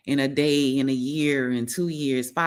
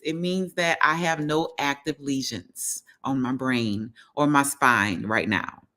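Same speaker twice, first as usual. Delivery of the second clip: usual speaking voice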